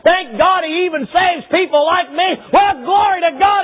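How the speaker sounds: high, loud fervent shouting; full-force preaching. Acted. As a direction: preach it at the top of your voice.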